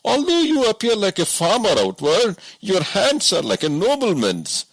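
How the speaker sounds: a simple woodsman who can't believe it; woodcutter voice. A narrator's reading. Woodcutter points out smooth hands.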